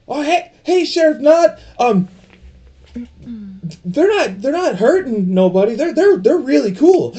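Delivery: put-on high, eager kid voice; excited boy king. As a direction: chatter like an eager little boy. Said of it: "Grover will be like".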